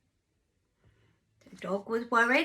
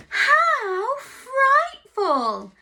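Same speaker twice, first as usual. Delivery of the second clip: very slow, loud, drawn-out dismay; hammed-up farmyard gasp of dismay; dismayed chorus; 11 semitones higher, 9 dB louder, much slower than usual. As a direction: stretch it out in mock horror. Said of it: "said everyone".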